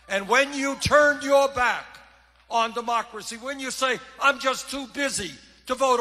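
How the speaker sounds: loud, fired-up stump speech; rally speech. Acted. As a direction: rouse the crowd from the podium.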